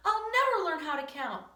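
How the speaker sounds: high, loud wail of frustration; despairing outburst. Acted. Pupil tells teacher he'll never count, despairing.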